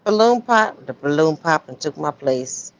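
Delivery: normal talking voice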